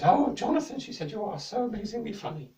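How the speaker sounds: high, gushing queen voice; high, delighted royal praise. A narrator's reading. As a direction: gush over the jester.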